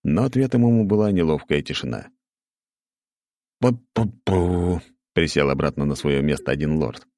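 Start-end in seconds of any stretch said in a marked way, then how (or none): 3.6-4.8 slow, embarrassed humming; awkward hum. Acted. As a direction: hum awkwardly to dodge the question. Awkward lord hums, sits down.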